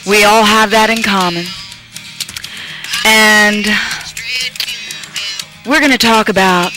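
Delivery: rowdy party animal. Acted. Radio host preaching over music.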